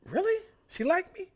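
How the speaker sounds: high, excited disbelief; surprised. Retold or imitated. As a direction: gasp it out, thrilled. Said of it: "Mark was like".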